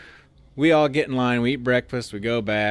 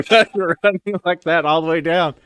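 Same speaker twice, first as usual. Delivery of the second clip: laughing; 8 semitones higher, 8 dB louder than usual. Retold or imitated. Running with bags, laughing.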